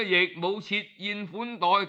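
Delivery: ordinary delivery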